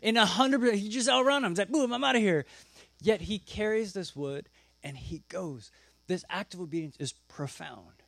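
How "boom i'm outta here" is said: high, loud, punchy exit line; gleeful getaway. Acted. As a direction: toss it out as if bolting. Son gleefully escaping his father.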